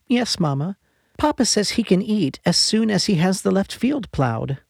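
put-on bright high child voice, pitched well up; young girl. A narrator's reading. Girl answers her sick mother, high child voice. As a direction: play an eager ten-year-old girl.

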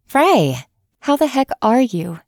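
bright smiling hello; smiling phone greeting; cheerful greeting. A narrator's reading. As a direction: greet an old friend warmly. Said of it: "answered with a smile".

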